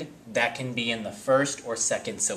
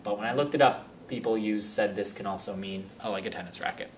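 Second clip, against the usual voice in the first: ordinary talk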